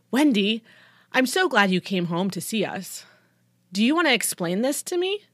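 loud, pointedly sarcastic welcome. A narrator's reading. Dad confronts daughter over a report, sarcastic. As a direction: lay the sarcasm on loudly. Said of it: "He had this sarcastic voice on".

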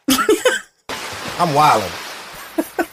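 laughing it out slowly; amused, drawn-out laugh while talking. Real, not acted. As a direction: chuckle through the words at yourself. Laughing at his own remark.

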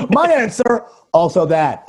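high, loudish mock of a friend; mocking imitation. Mocking friend who piggybacked his answer.

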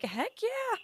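high cheer without much volume; mock crowd whoop; imitated cheer. Cast members cheering a stranger's news, gleeful.

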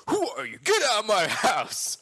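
mock angry shout. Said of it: "I said".

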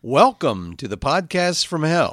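slow, loud show opening; big, deliberate host's welcome; podcast intro. Acted. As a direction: announce the show grandly, drawing it out. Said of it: "That was my intro".

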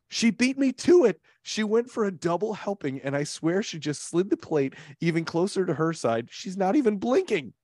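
dramatize the pastry standoff; excited indignation. Acted. Reading escalating tweet drama.

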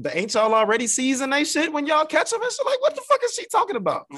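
high, loud disbelief; can't believe what she's saying; incredulous. Real, not acted. Exasperated rant about the mayor.

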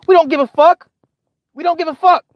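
high, loud brush-off; exasperated dismissal. Acted. Viewer shutting down streamers' travel updates, exasperated. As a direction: push it up high, utterly uninterested in the boast. Mock retort to bragging streamers.

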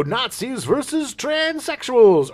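slow, big announcer. Retold or imitated. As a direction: announce the episode title. Announcing talk show episode title.

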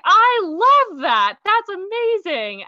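high, slow, delighted mock-mom voice. Son retelling his mom's reaction, high, slow delighted mock voice. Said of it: "she was like".

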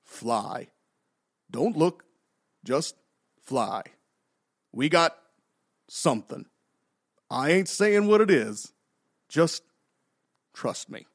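halting, dubbed-over pilot lines; spaced-out phrases like a bad dub; overdubbed voice. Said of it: "he says with this overdubbed voice".

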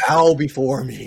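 slow, booming villain command; loud, slow mock evil overlord; dark lord. Gloating over leading the standings.

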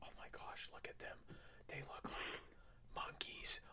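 whispered, breathless and awed; a voiceless whisper of wonder; alien whisper. Pretend. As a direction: hush it like a nature watcher spotting apes. Aliens whisper about humans.

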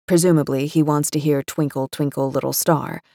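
usual speaking voice

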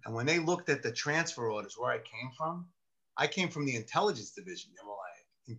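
normal talking voice

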